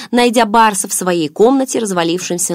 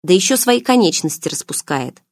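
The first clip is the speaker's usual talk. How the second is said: ordinary delivery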